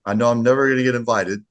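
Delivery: eager Aussie offering to work; hammed-up Australian accent. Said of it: "my Australian accent".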